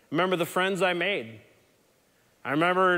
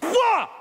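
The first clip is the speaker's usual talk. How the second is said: high loud whoop; a whooping "Wah!" high and loud; mock cattle-roper yell; 7 dB louder than usual. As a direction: yell it like a rodeo cowgirl. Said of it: "She was like".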